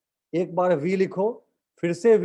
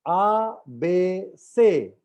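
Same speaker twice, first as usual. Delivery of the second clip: आ, बे, से drawn out slowly; much slower than usual. Shown as a demonstration. Teacher slowly modelling French letters आ, बे, से. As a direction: stretch आ, बे, से. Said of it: "बड़े धीमे से बोलता हूं".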